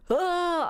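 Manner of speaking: high strained grunt; pushing grunt. Retold or imitated. Mom retelling the delivery room, high straining grunt. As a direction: bear down with a grunt. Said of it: "they're like".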